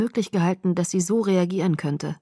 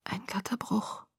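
slow, barely voiced whisper; 3 semitones higher, 11 dB quieter than usual. A narrator's reading. Bella answering Edward in a stunned whisper. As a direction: breathe it without moving lips. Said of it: "flüsterte ich".

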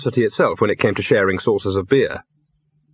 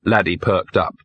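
plain reading voice

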